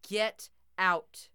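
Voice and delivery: booming shout of warning; loud menacing shout. A narrator's reading. Toy shouts at the intruder, menacing shout. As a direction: bellow it at the intruder. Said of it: "Grandpop's voice echoed from Jock".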